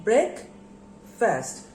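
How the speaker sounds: BREAKFAST split in two, with a long gap between break and fast; syllable by syllable. Shown as a demonstration. Teacher counting syllables for pupils, broken into syllables. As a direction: say BREAKFAST as two separate beats. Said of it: "how many syllables are there".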